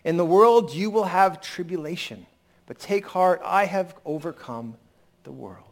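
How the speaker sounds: slow, solemn scripture reading. Acted. Pastor reading scripture aloud to the congregation, slow and solemn. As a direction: read the verse slowly, weighing each phrase. Reads scripture slowly in sermon.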